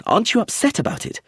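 fast, high pressing question; demanding. Acted. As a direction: push her for an answer. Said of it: "I demanded".

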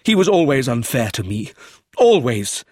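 loud, aggrieved outburst; exclaiming. A narrator's reading. Son complaining of his father's unfairness, exclaiming. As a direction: protest with feeling breaking through. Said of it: "he exclaimed".

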